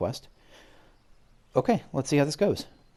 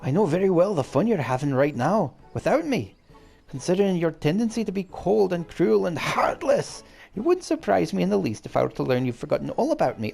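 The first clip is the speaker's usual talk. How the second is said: hammed-up Scottish accent, pitched higher; bad Scottish accent; 7 semitones higher than usual. Streamer reading the Fairy King's letter in a bad Scottish accent. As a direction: lay on a clumsy Scottish accent for the Fairy King. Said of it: "the worst Scottish accent in history".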